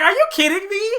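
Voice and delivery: high, loud thrilled disbelief; retold excitement. Fan retelling seeing the fight animated: high loud thrilled disbelief. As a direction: yelp it in joyful disbelief. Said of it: "And I'm like".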